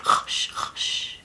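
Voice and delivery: soft voiceless shushing; whispered hushing. Pretend. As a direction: hush it gently in a whisper. Hushing toy pig to sleep.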